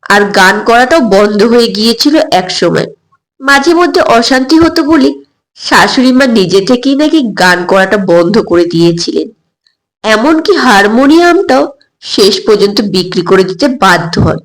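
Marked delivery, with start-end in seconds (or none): none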